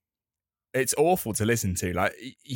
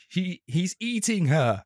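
horrified quote; 7 semitones higher, slower than usual. Retold or imitated. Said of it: "you're like".

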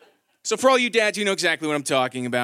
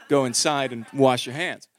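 normal talking voice

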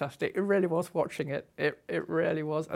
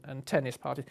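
ordinary talk

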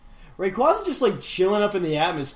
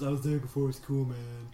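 quick, lower, lightly voiced hippie; 6 semitones lower, 6 dB quieter than usual. Repeating the brag about Divine Purpose, light hippie voice. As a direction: toss it off like a hippie. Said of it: "Hippie voice".

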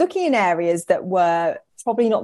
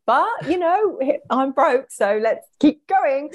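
high-pitched mock pep talk; put-on high-voiced self-persuasion; 7 semitones higher, slower than usual. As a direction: go high and push yourself onward. Talking herself into carrying on.

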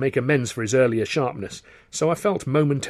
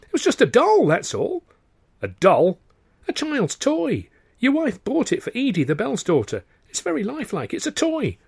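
flustered explaining; 13 semitones higher, 3 dB louder than usual. Acted. Hasty alarmed explanation to employer.

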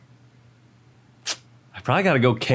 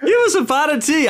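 English accent; 3 dB louder than usual. Shown as a demonstration. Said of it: "that came out a lot better".

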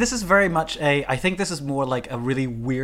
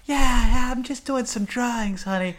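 mock Marilyn voice; 7 semitones higher than usual. Voicing Marilyn.

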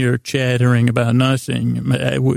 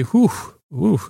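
a quick breathy whew, pitched up; mock relieved whew; 5 semitones higher than usual. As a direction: puff out a little whew of relief. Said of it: "and to say".

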